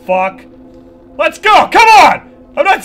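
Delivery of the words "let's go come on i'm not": loud, high challenge yelled at the monster; bravado shout. Real, not acted. Taunting the slender creature in the game, a loud bravado shout. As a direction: psych yourself up, shout him on.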